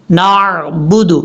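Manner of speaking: slow 'نعبد' with throaty ع; clear ain. Shown as a demonstration. Teacher presents the word, slow correct recitation. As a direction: break 'نعبد' after a squeezed naa. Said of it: "یہاں پر یہ دیکھیں".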